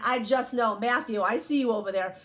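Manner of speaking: ordinary delivery, nothing marked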